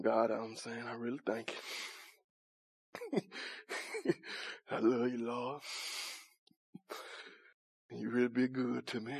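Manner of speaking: mocking a hushed, muttered prayer; quiet, solemn prayer. Preacher mocks timid worshippers with a hushed, low prayer. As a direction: pray under your breath, all low and solemn. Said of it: "quiet and our solemn".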